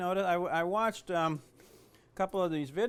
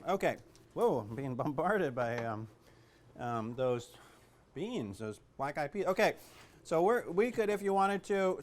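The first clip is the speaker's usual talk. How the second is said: ordinary talk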